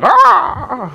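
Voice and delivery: high cawing crow. Pretend. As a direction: caw like an offended crow. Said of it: "that crow, like".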